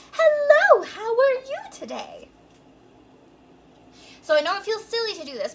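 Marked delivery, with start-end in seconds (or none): 0.1-2.3 high, lifted princess head voice; sing-song princess greeting, pitched way up; Disney princess. Shown as a demonstration. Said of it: "say in your most Disney princess voice".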